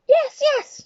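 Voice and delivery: high, loud excited shout. Acted. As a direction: shout insistently. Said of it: "shouted the first witch".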